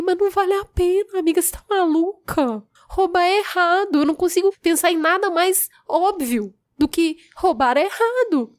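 high, incredulous lecturing of a friend; pleading reproach to her friend; exasperated scolding. Retold or imitated. Scolding friend about stealing.

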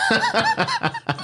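high, drawn-out laugh through the words. Real, not acted. Laughing as he recalls smacking the guy. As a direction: laugh it out while telling the punchline.

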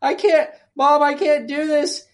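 imitating a panicked girl's high whimpering plea; mock panicked daughter. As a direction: play the scared daughter calling for mom, overdone. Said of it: "she's going".

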